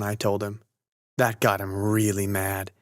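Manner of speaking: ordinary delivery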